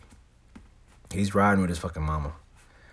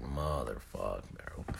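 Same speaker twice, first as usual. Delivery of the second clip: low, quiet dreading curse; in the dumps; 9 semitones lower, 13 dB quieter, much slower than usual. Retold or imitated. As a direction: sink into a soft, defeated swear. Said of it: "I'm in the dumps".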